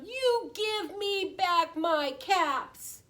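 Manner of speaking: slow, high demand; scolding peddler. A narrator's reading. Peddler wanting his caps back, scolding. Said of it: "shaking a finger at them".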